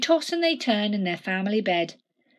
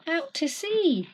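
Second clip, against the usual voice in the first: ordinary delivery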